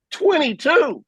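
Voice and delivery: high-pitched, amazed repeat; incredulous exclamation. Real, not acted. Broadcaster marvels at the point spread, amazed exclamation. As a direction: repeat the figure in disbelief, voice leaping up.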